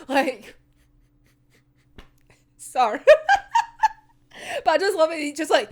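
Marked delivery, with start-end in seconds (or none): 3.0-3.9 loud high giggle; high laugh. Real, not acted. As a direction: burst out laughing. Laughing at the panel.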